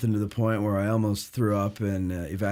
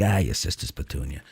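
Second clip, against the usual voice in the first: lower, gruff Brooklyn grandpa; 8 semitones lower, 4 dB quieter than usual. Retold or imitated. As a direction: grumble it like an old Brooklyn man. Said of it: "he'd be like".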